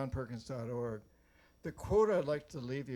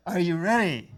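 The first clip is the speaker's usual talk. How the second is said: louder, higher rallying cry to the crowd; rally shout; 4 semitones higher, 13 dB louder than usual. Shown as a demonstration. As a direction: fire up the audience. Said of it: "I wanna hear a big yes".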